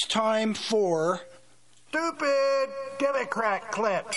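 slow, drawn-out announcer voice; segment announcer. Acted. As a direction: announce it like a show jingle. Host announcing a show segment.

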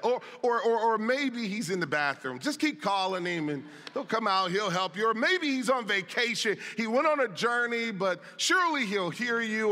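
high, mocking sarcastic taunt. Elijah taunting Baal's prophets, high and sarcastic. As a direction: needle them with fake encouragement. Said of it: "notice the sarcasm".

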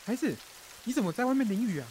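high, hurried call indoors; urgent calling. Acted. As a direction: call out quickly, pitched well up. Calls someone in from the rain.

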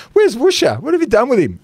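high, shocked comic question; mock alarm. Mock alarm at transformed teammate.

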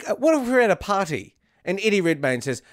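ordinary talk, nothing marked

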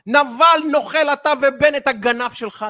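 host mocking what the audience wants shouted; mock shouted insult. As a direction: spit out the insults for show. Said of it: "מי שמצפה שאני אצרח".

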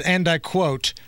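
ordinary delivery

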